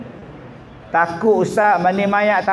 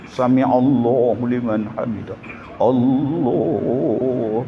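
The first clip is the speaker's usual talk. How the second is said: lower, drawn-out prayer call; imitating imam's call; 6 semitones lower, 3 dB quieter, slower than usual. Mocking the imam leading prayer, a low drawn-out call.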